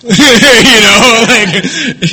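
high laugh through words; laughing out loud. Real, not acted. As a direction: crack up at the memory. Laughing at the girls' reaction.

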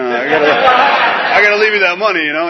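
high, loud exasperated plea; exasperated pleading. Retold or imitated. Son telling dad he must leave the money, high and loud.

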